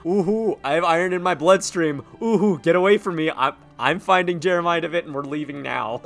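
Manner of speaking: high, loud mock ghost hooting; spooky ghost taunt. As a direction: hoot like a silly ghost.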